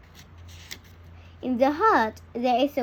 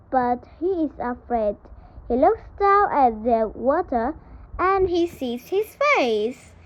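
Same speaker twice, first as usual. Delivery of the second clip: plain reading voice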